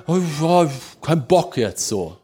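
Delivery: slow, slack groan of reluctance; unmotivated youth. Acted. Preacher imitating people with no drive today, mock listless. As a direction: sigh it out like you can't be bothered. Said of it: "Das ist so, das ist so".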